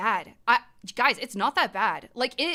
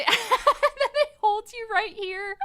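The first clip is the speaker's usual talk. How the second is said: laughing and slow, pitched up high; 13 semitones higher, 3 dB louder than usual. Real, not acted. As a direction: giggle through the hold. Laughing describing hang time.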